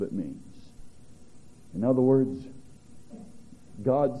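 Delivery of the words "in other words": normal talking voice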